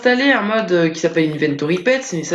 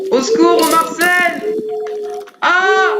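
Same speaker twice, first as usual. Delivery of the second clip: much higher, louder hammed-up panic cry; mock cry for help; 12 semitones higher, 4 dB louder, slower than usual. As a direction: wail for help in comic terror. Skeleton keeps attacking.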